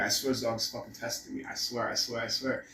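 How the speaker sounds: low, soft muttering under the breath; quietly exasperated owner; muttered frustration. Retold or imitated. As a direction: grumble to yourself, barely patient. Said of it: "I'm like".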